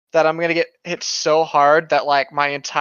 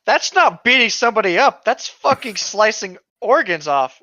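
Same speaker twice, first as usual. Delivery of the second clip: higher, louder disbelief; incredulous outburst; 6 semitones higher, 3 dB louder than usual. Real, not acted. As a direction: protest in disbelief. Incredulous objection.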